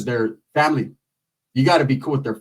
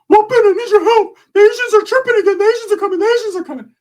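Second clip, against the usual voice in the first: much higher, louder frantic voice; panicked Ricky voice; 6 dB louder than usual. Retold or imitated. Ricky calling Ben for help, high frantic caricature. Ricky's phone call, put-on voice.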